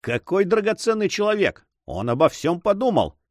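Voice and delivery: high, delighted praise; laughing exclamation. A narrator's reading. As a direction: exclaim merrily, without actual laughter. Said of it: "воскликнул, смеясь". (радостно, с восхищением)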